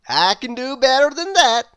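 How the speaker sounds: loud proud storybook boast; Mister Impossible boasting. A narrator's reading. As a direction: brag it like nothing is beyond you. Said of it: "replied Mister Impossible".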